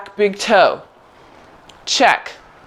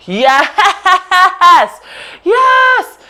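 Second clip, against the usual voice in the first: excited cheering; 9 semitones higher, 5 dB louder than usual. Real, not acted. Cheering a student on.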